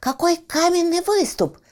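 high, loud and eager little pup; a thrilled young puppy shouting; excited puppy voice. Acted. Щенок восхищается выступом. (восторженный щенок)

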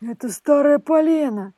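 the old man mocks her claim; gruff old man. A narrator's reading. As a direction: scoff at her foolishness.

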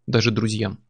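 plain reading voice

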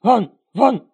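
loud barking yelp; barking dog. Acted. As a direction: bark it out like a chained dog. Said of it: "залаял цепной пёс".

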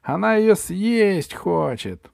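high, loud, drawn-out bird call; bird crying out. A narrator's reading. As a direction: call the alarm from above, high and stretched. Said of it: "крикнула с неба птица Дурында". (высокий протяжный крик птицы)

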